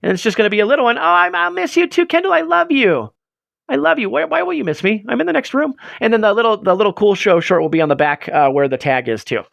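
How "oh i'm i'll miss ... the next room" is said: high-pitched cutesy character voice; lightly high, sing-song cartoon voice. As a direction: play the sweet little mascot, pitched up. Voicing a character shirt design.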